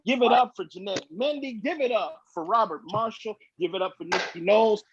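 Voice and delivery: fast announcer roll call. Real, not acted. Host rattling off performer names.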